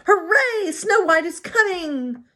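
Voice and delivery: high, slow, cheering dwarf voice; Happy telling the others, high and cheering. Acted. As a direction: shout it out like a happy little dwarf. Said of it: "Happy cheered".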